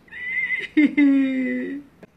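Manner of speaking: high, loud, squeamish laugh; nervous laugh. Real, not acted. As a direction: laugh through the creeps. The shells are still alive.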